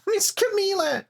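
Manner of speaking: put-on worried small girl, voice up high; a high, anxious child calling her friend; high little girl voice. Game character voiced in turns.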